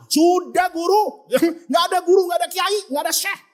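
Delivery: high, insistent list of denials; mock defiant retort. Disciple rejecting his guru, high acted indignation. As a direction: throw the titles back scornfully. Murid membantah gurunya dengan marah.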